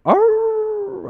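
long high loud howl; drawn-out imitated dog howl. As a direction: howl like a hound, held out. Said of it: "this dog just howling".

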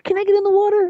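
high granny voice in awe and mild fright; old grandmother voice. Retold or imitated. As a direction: squeak it out like an amazed granny afraid to fall. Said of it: "She's like".

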